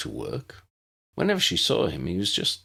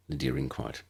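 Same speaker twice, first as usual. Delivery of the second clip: usual speaking voice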